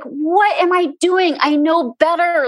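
mock frustration, as if caught with the snack; exasperated self-scolding. Health coach scolding herself for eating junk, exasperated.

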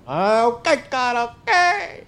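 high, slow sigh of giving in; mock grudging okay. Accepting God's no, high and drawn out. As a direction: give in to God with a stretched okay.

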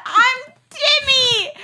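very high, loud, drawn-out wail; mock bawling. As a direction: burst into hammed-up sobs, shouting it. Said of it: "then the waterworks".

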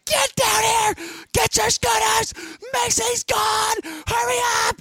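put-on very high, frantic shouting at the kids; panicked shout. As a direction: scream it across the park in a panic. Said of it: "And I'm like".

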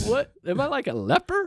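very high, disbelieving question; incredulous. Retold or imitated. Patient baffled by the nurse keeping her distance, incredulous. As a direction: ask it half-offended, half-amazed.

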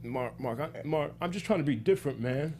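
nervously tripping over words; nervous stammer. Retold or imitated. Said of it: "I'm just stuttering like I am now".

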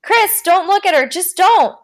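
high, loud, urgent shout; screamed warning. A narrator's reading. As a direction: scream a desperate warning through a door. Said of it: "she screamed across the door".

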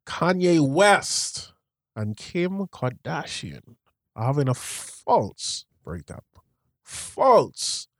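slow, emphatic preacher with punched key words; podcast preacher voice. Shown as a demonstration. Repeating a friend's gossip sentence as a demo, podcast preacher voice. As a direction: preach it slowly, leaning hard on FALSE. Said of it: "here's my podcast feature voice".

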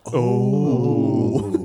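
long drawn-out knowing "oh"; group reaction. Acted. Everyone hearing the title dropped, drawn-out group "oh". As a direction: draw the "oh" out like an impressed crowd. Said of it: "And everyone goes".

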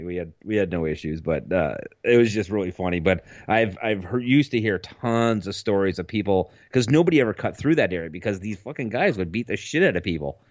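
ordinary delivery

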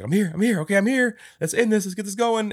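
high, loud, breathless urgency; frantic. Retold or imitated. At his own grave, urging Future on excitedly. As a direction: rush it out, keyed up.

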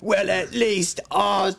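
high, loud, slow cartoon horse; drawn-out, high-pitched mocking horse voice; ugly horse retort. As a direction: imitate a big-toothed horse firing back. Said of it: "super ugly horse with giant teeth".

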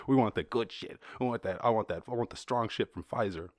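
ordinary delivery

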